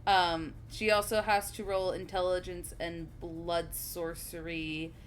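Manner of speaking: ordinary talk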